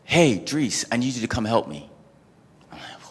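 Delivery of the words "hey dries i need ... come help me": higher, louder friend calling for help; urgent friend on the phone, higher and louder; caller on the phone. Retold or imitated. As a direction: call out for help over the phone. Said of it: "I get a call".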